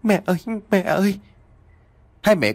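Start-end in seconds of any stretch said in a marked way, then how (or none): 0.0-1.2 high, quick tearful call; mild sobbing. A narrator's reading. Long calls to his mother after the death, mildly tearful. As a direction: call out high as a distressed child. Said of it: "Long nức nở nói".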